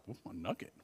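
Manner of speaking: very soft, low, hammed-up curiosity; mock intrigue. Listener reacting to podcast pastor's nugget, mock hushed intrigue. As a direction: play along with the hush.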